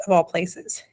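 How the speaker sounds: an understated, wondering afterthought; wondering aside. A narrator's reading. Mother telling the scandal, adds a wondering aside. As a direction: drop it in as a mildly puzzled aside. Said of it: "added suddenly in a wondering aside".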